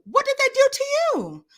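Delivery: high, pleading disbelief; incredulous high question. Real, not acted. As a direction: ask it in disbelief. Challenging the viewer.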